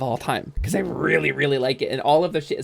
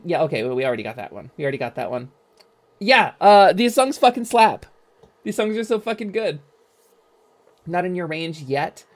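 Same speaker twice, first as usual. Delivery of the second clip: ordinary delivery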